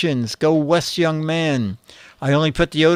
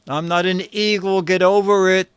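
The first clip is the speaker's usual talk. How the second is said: ordinary delivery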